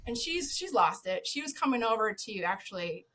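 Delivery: normal talking voice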